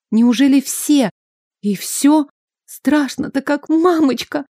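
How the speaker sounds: high, trembling with fear; frightened. Acted. Princess panics in ambush.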